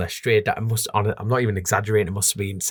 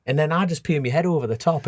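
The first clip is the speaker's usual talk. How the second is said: ordinary talk, nothing marked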